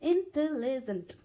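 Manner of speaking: Intelligent said slowly in parts, in-te-li-jent, leaning on the middle; intelligent drawn out with a stressed middle; syllables with stress. Shown as a demonstration. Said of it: "हमें stress लेना होता है बीच में".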